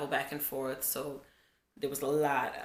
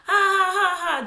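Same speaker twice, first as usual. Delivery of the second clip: high, loud imitation of a kid's laugh; mock laugh; 13 semitones higher, 9 dB louder than usual. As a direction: cackle it out like a giddy little girl. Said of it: "She's like".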